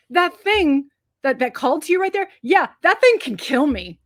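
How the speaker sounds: high, loud mock explaining; hammed-up god giving it all away; blabbing god. God telling Gore about the deadly sword, exaggerated blabbing. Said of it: "And he's like".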